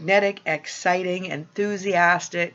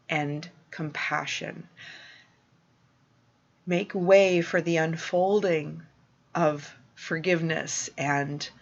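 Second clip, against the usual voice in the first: softer, lower, tender reassurance; compassionate; 3 semitones lower, 3 dB quieter than usual. Real, not acted. Acknowledging listeners' pain and picturing them in peace, gently compassionate.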